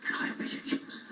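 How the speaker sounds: breathy library whisper of the same order. Acted. The comedian whispering his order to the librarian. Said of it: "This is a library".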